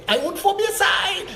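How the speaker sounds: high, put-on voice of a boastful property owner; mock the rich landowner bragging. Candidate mocking wealthy owners, high put-on boast. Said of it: "That's how they talk".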